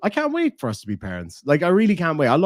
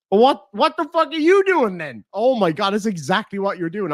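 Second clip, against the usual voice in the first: incredulous retort; 7 semitones higher, 4 dB louder than usual. Retold or imitated. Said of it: "I'm like".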